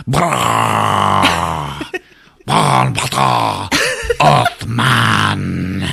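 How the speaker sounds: drawn-out monster growling; Drac alien voice. Retold or imitated. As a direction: imitate an alien confronting a captive. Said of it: "the Drac gets up in his face".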